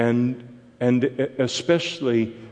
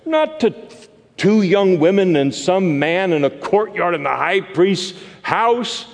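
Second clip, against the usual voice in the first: rising, much higher, louder preacher's climax; preaching build; 8 semitones higher, 4 dB louder than usual. Real, not acted. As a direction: build it up to the crowd of pilgrims.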